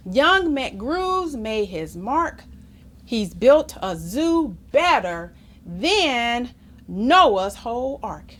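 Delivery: usual speaking voice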